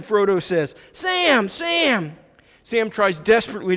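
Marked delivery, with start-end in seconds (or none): 0.9-2.2 high-pitched cry of a name; alarmed calling. A narrator's reading. Frodo calling to Sam in the river, alarmed. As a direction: call out to a friend in danger, pitched high.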